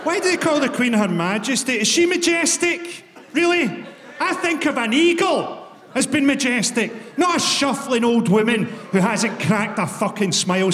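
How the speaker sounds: indignant shouted rant at the Queen; comic rant. Real, not acted. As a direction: build the outrage until you're yelling. Ranting at the Queen.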